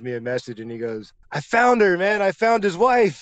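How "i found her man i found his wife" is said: excited news. Retold or imitated. Colin excitedly reports finding George's wife.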